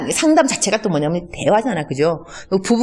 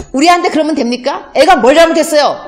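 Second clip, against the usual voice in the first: higher, louder indignant protest; angry parent; 7 semitones higher, 5 dB louder than usual. Retold or imitated. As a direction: confront the teacher in outrage. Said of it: "이러면 안 되지".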